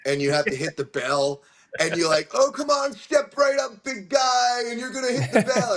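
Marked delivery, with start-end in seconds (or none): 2.3-5.7 carnival barker. Retold or imitated. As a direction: pitch it like a fairground huckster.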